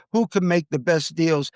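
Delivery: ordinary talk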